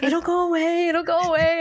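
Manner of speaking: high mock-pained reassurance; self-soothing. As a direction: replay talking yourself through it in a high voice. Reassuring self while suffering, retold.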